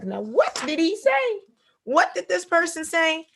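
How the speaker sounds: a listener slowly wondering aloud; mock puzzled reader. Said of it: "be like".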